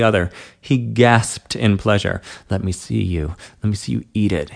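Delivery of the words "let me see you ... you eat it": husky. Acted. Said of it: "he husked".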